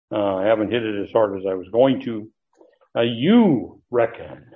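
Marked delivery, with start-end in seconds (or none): 3.0-4.5 "You" stretched out with a big pitch swell; emphatic YOU. Shown as a demonstration. Teacher reading the Greek verse to the class, emphatic "You". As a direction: lean hard on "You", then drop on "reckon". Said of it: "You emphatically reckon".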